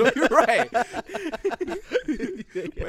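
laughing loudly through the words; can barely get it out for laughing. Real, not acted. Laughing at teacher's absurdity.

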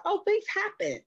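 very high, airy shrug of a reply; breezy dismissal. Retold or imitated. Answering nosy people, high airy dismissive shrug. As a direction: toss it off lightly. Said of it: "I was like".

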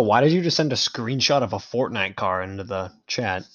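ordinary talk, nothing marked

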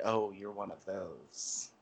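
low, soft, slow imitation of disdain; eye-rolling dismissal. Imitating the lady's reaction to his refusal, eye-rolling dismissal. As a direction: voice an eye-roll under your breath, drawn out. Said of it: "rolled her eyes as if to say".

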